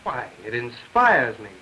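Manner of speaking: loud, slowed sarcastic shout; husband jokes loudly about the woodpecker noise; ironic shouting. Acted. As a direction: shout the joke with dry irony over the racket.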